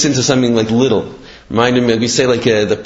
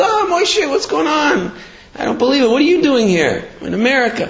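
high-pitched astonished greeting; pitched way up, happily amazed; delighted surprise; 13 semitones higher than usual. Acted. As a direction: greet an old friend you can't believe is here. Surprised reunion with old friend.

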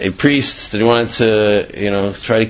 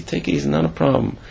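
calming; 4 semitones higher, 5 dB quieter, faster than usual. Acted.

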